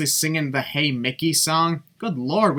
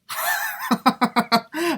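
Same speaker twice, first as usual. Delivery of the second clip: high-pitched laugh. Real, not acted. Laughing at referee.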